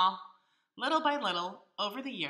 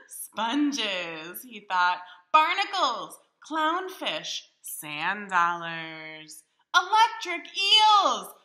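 excited listing; 9 dB louder, much slower than usual. Acted. Crab excitedly plans his decorations.

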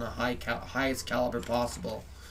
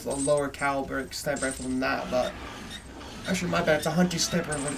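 usual speaking voice

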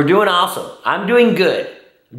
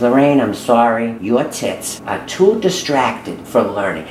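librarian voice; 9 semitones lower than usual. Retold or imitated. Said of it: "And he says".

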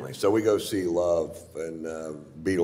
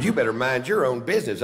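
snippy warning; 5 semitones higher, 5 dB louder than usual. Retold or imitated. Woman warns him.

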